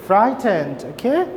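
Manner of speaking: ordinary talk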